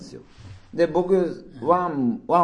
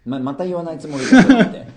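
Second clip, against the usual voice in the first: laughing; 8 semitones higher, 12 dB louder than usual. Real, not acted. Teasing while laughing.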